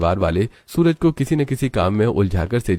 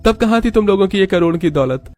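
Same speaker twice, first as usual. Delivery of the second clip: high-pitched heated rebuke; angry husband; 12 semitones higher, 3 dB louder than usual. Acted. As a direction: silence the family and reproach them. Husband silences his family.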